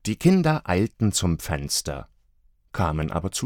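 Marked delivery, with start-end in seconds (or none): none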